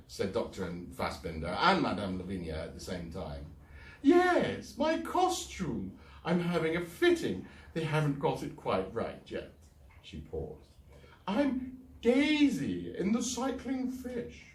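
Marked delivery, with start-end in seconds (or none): 4.0-14.5 high, slow actress voice; theatrical diva. Acted. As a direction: preen about the costume. Eugenie diva voice.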